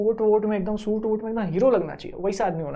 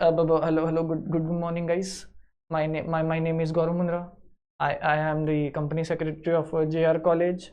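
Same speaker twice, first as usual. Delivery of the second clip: lower, softer, slow stumbling speech; nervous stammering speaker; 6 semitones lower, 4 dB quieter, slower than usual. Retold or imitated. Nervous speaker with stage fright: lower, soft, halting. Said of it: "ऐसा नहीं लगना चाहिए".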